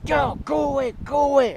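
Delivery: high, loud mock shout of go away; imitating an annoyed shopkeeper; grumpy shout. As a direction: chase them off from the window. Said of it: "And he goes".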